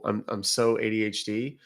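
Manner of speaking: ordinary talk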